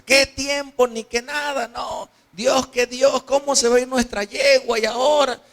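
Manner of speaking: high, indignant outburst; desperate impatient son. Acted. Son rejecting his father's counsel about the mare, desperate.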